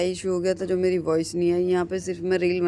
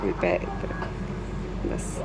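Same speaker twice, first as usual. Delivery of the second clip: slow, low whisper of "इधर रखो"; whispered instruction; 7 dB quieter, much slower than usual. Real, not acted. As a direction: breathe the words out slowly without voice. Directing him, then whispered. (धीमे से फुसफुसाकर कहना)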